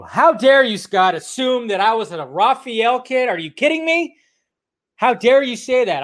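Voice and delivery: mock outrage. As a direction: pretend to be insulted, in jest.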